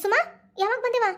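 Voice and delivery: high, anxious questions from a startled mother; alarmed surprise. Acted. Mother sees her crying daughter and asks anxiously.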